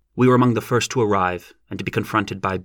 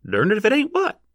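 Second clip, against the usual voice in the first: demanding rustic voice; 13 semitones higher than usual. A narrator's reading. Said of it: "demanded a voice from the rear".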